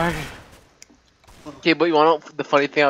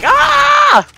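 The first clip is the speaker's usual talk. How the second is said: loud mock yelp; a quick yelp of pretend pain; retold yelp; 10 dB louder than usual. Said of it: "I acted like I was on hot lava".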